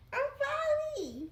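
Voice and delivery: high, slow put-on squeak of sorry; mock squeaky apology. Owner apologising to her dog in a high slow squeaky voice.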